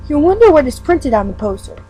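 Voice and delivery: plain reading voice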